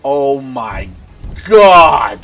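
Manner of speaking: loud, high, drawn-out panic; panicked yell. Real, not acted. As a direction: yell in alarm as it goes wrong. Panicking at in-game attack.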